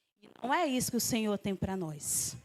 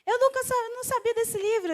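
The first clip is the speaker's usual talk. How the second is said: put-on higher, eager young women; girls after the service; 10 semitones higher than usual. Girls telling the preacher about the book, eager higher voice. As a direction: voice the surprised girls up high. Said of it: "umas meninas vieram".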